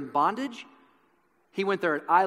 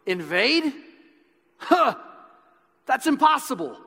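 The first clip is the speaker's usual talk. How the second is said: high, loud scoffing disbelief; snarky disbelief; 10 semitones higher, 6 dB louder than usual. Retold or imitated. Nobleman scoffing at Wallace's plan, snarky disbelief. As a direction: sneer it like it can't be done. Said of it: "with, like, this snarky disbelief of".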